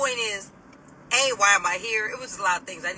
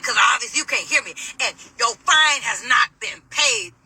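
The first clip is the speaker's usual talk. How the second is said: louder mock yell, rapid then slowing right down; imitated yelling; 3 semitones higher, 6 dB louder than usual. Officer telling detainee her fine is unpaid, imitated yelling. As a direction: mimic the officer yelling, spelling out the last part. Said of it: "yell at me about everything you say".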